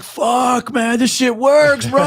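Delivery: high, loud, slowed-down amazed cry; amazed shout. Retold or imitated. Officers amazed the taser worked, high slow shout. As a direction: whoop it out in disbelief. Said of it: "We're like".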